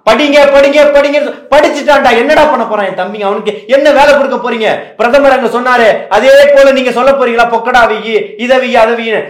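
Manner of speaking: loud, high, forceful harangue; indignant tirade. Real, not acted. Party speaker challenges leaders on jobs for youths, indignant.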